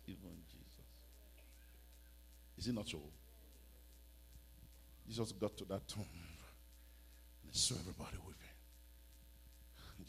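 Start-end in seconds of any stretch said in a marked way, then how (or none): none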